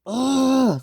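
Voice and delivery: high, drawn-out cry; mock scream, long and high. Sydney after the crash, high mock scream. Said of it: "screamed Sydney".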